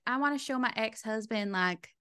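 light imitation of a Southern woman's accent. Quoting her line about the ex-husband, imitated Southern accent. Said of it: "Southern accent and I just did that".

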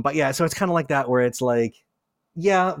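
ordinary talk, nothing marked

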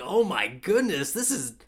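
loud, high-pitched wail of mock agony; overwhelmed outburst. Viewer reliving a character's screaming scene, overwhelmed.

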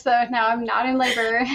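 usual speaking voice

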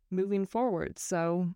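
ordinary talk, nothing marked

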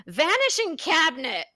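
American version of "Vanishing cabinet"; American accent. Shown as a demonstration. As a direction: say "Vanishing cabinet" the American way, every syllable plain. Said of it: "here we are with our American accents".

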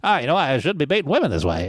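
put-on thick old-time Detroit accent. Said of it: "that's my best Detroit accent".

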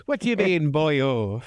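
lightly voiced stage Irish repeat; a hint of Irish brogue; Irish accent. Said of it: "who's doing a fantastic Irish accent".

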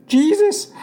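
high, earnest call in prayer; praying. A narrator's reading. Boy praying over his sick father, high and pleading. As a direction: call on Jesus, pitched well up. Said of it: "I prayed".

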